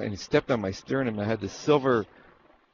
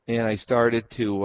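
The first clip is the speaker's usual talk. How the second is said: ordinary talk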